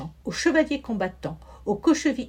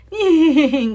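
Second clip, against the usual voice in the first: loud gleeful cackle, higher; wizard's creaking laugh; 6 semitones higher, 12 dB louder than usual. A narrator's reading. Wicked wizard cackling over his spell, loud creaking laugh. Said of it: "Grinça le sorcier".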